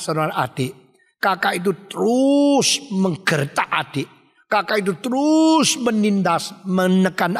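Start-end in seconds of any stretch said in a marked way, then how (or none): 1.9-2.8 "terus" drawn out long, rising and louder; emphatic stretch. A narrator's reading. 5.0-5.8 "terus" held long, high and loud; emphatic stretch. A narrator's reading. Drawn-out emphasis, oppressing brother.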